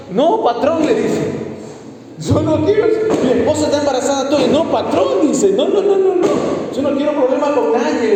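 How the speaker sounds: high, flustered denial to the boss; nervous bricklayer. Acted. The bricklayer answers the husband. (albañil nervioso, voz aguda, niega todo ante el patrón)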